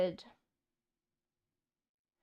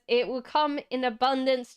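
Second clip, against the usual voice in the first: ordinary talk, nothing marked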